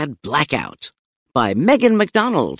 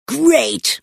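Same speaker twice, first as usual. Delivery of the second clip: sarcastic; 8 semitones higher than usual. Acted. Stink complains sarcastically.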